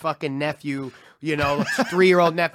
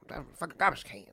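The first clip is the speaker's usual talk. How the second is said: soft practice impression; quietly trying out a comic voice; Chappelle warm-up; 10 dB quieter than usual. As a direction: rehearse it under your breath. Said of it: "I'm like".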